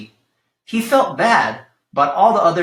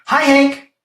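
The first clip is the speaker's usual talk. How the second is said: high, loud friendly call; tumbleweed hailing her neighbor, high and loud; 10 semitones higher, 6 dB louder than usual. Acted. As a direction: call out a cheerful greeting from afar. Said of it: "Rosie the tumbleweed called out".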